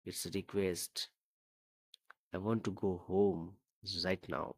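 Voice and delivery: slow, understated plea; gently insistent, unhurried begging; slow pleading. A narrator's reading. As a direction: draw out each word of the request. Frightened girl pleads to go home.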